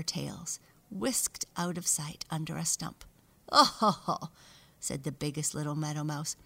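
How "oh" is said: scornful. Acted. Boastful mouse scoffs.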